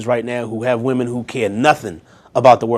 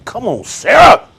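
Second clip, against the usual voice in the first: higher, louder and slower, cheering Sarah on; rousing cheer; 10 semitones higher, 6 dB louder than usual. Real, not acted. Preacher cheering Sarah's faith to the congregation, loud and high. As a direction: call out in delight, urging her on.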